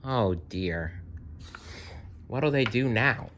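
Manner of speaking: usual speaking voice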